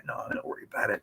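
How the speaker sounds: breathy hush, waving it off; soft whispered no-worries. Retold or imitated. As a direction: whisper it like a kind brush-off. Retold refusing money, whispered.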